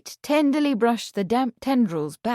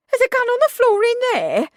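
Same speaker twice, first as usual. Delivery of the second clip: high, loud and fast shocked question; much higher, alarmed disbelief; aghast; 8 dB louder, faster than usual. Acted. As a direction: blurt it out in horror.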